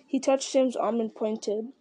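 ordinary delivery, nothing marked

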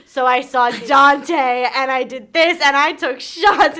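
slow, loud, punched-out boast; hyped party recap. Retold or imitated. Roommate bragging about the weekend, hyped-up. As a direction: hammer out each wild deed, drawn out. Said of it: "And then I'd be like".

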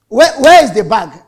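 loud harsh demand for the bag; demanding shout. Retold or imitated. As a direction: bark out the demand like an impatient nurse. Nurses shouting at him.

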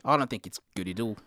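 low, soft put-on Kiwi accent; New Zealand accent. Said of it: "doing a New Zealand accent".

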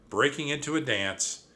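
ordinary delivery

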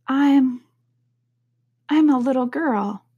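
hesitate, then offer it unsurely; doubtful. A narrator's reading. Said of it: "rather doubtfully as she remembered".

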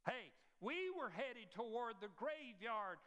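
rising, rhythmic preacher's cadence; preaching at full force. Acted. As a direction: build to glory, each title ringing out. Climactic preaching to congregation.

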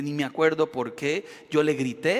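ordinary talk

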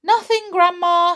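high, loud innocent call. A narrator's reading. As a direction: call back to the next room. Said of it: "he called out".

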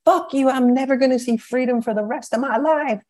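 high-pitched mock scream, held back in volume; imitated screaming. Inmate who will never see freedom, mock screaming.

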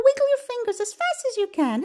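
high, hurried singsong; very fast. Shown as a demonstration. Telling babies to wiggle fingers, fast high voice. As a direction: race through it up high. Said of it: "as fast as we can".

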